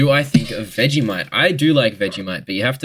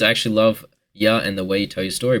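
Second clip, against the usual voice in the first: ordinary talk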